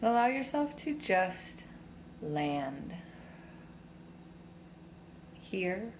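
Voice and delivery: slow, soothing meditation voice; guided meditation. Real, not acted. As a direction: guide them inward slowly, with long pauses. Leads a standing meditation.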